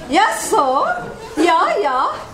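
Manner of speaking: ordinary talk, nothing marked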